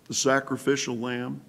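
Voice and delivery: ordinary talk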